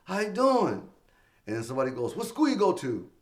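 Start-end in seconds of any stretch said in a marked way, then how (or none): 0.0-0.9 higher, forced brightness; strained eager greeting; forced cheerful opener. Retold or imitated. Uncomfortable stage opener. 2.2-3.1 higher, challenging heckle; lightly voiced teen heckler; heckler question. Heckler in the crowd.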